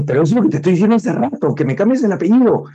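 high, fast, exasperated snapping; aggressive retort. Retold or imitated. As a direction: blurt it out, impatient and pushy. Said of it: "agresivo con mi tono y con mi voz".

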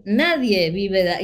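usual speaking voice

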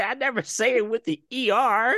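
high mock-indignant self-defence. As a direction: imitate a man protesting his innocence. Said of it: "he's just so indignant".

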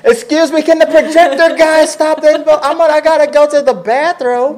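high, laughing mock panic; squeaky nervous theater patron; panicked moviegoer.

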